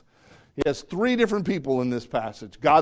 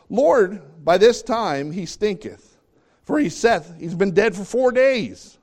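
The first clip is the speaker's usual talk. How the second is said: pleading protest; 8 semitones higher, 7 dB louder, slower than usual. Acted. Martha protests to Jesus.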